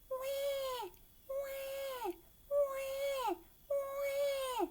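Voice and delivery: put-on high wailing baby cries; baby crying. A lost baby brother crying in the gorilla cage, high wails. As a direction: wail like a newborn. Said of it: "she heard".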